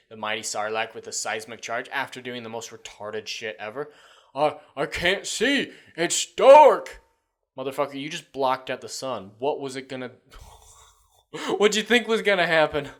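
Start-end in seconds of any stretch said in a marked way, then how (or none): 4.3-7.0 loud, high, slow dim-witted whine; mock helpless complaint. Mocking character's complaint in retold scene. 10.8-12.9 loud, high laughing question; incredulous laughing. Real, not acted. Laughing in disbelief at the scene.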